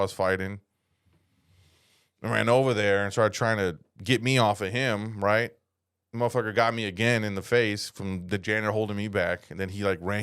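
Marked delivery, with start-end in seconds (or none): none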